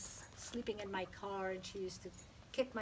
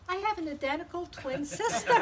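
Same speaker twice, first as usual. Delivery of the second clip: laughing punchline; 10 semitones higher, 11 dB louder than usual. Real, not acted. Punchline of the twin story.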